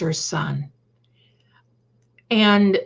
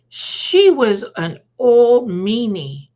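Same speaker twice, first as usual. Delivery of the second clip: slow, drawn-out, louder, a mild mother's voice; mom's slow consoling line; 3 semitones higher, 6 dB louder, slower than usual. Retold or imitated. Mom on the phone to her crying daughter, slow and drawn-out. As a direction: stretch it out like comforting mom. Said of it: "And she said".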